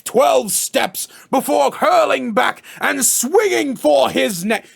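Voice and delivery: slow, grandiose stage declaiming; mock-Shakespearean, drawn-out bombast; Shakespearean monologue. Shown as a demonstration. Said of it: "long-ass Shakespearean monologues".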